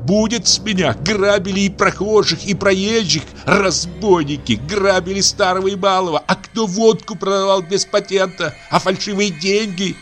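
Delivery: high, tearful angry tirade; furious crying rant. Acted. Aksinya shouting at the old man through tears, furious. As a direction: scream her grievance at the family. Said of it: "продолжала Аксинья кричать".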